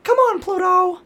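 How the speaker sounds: very high squeaky mouse calling his dog; bad Mickey Mouse. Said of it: "fucking awful Mickey Mouse impression".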